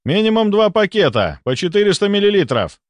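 high, urgent called instructions; calling after someone. A narrator's reading. Doctor orders two bags of plasma, calling after the runner. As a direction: call the order down the corridor. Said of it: "крикнул я вслед".

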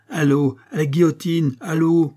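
high, loud mob chant; bay for the guillotine; crowd jeering. A narrator's reading. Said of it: "répondirent vingt voix". (la foule hurle, haut et fort)

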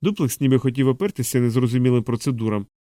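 usual speaking voice

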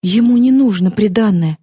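plain reading voice

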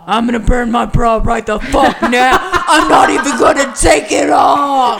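high, full-volume comic outrage; hammed-up furious yell. Podcaster's reaction to watching the show, comic outraged shout. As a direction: shout it like a fired-up protester.

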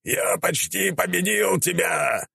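high, drawn-out hero cry; hammed-up high-pitched warrior shouting; theatrical shout. A narrator's reading. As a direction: stretch it out in a high, strained battle cry. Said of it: "прокричал Святогор".

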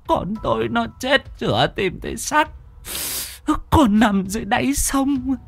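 high-pitched bitter wail of a bereaved mother; anguished hissed lament. A narrator's reading. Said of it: "Bà thều thào rít qua kẽ răng".

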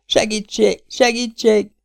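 high, desperate "Segítség!" twice; fading cry for help. A narrator's reading. Mabel being drugged by the kidnappers, calling for help in a high desperate cry. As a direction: cry out as the cloth smothers her. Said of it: "kiáltott a lány elhaló hangon".

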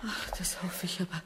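soft, fast breathless whisper; weary whisper. Acted. Sick mother answering her son, exhausted whisper. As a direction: sigh it out, spent.

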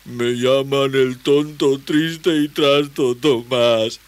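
slow, high, tearful lament; loud, drawn-out blubbering; self-pitying wail. Acted. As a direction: moan each word out miserably. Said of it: "lloriqueó el desconocido".

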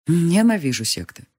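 ordinary delivery

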